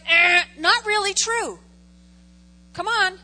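ordinary talk, nothing marked

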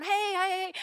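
high, loud mock hellos; rushed greeting. Spouse hurrying past loved ones, rushed greeting. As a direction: toss out loud, high heys in passing.

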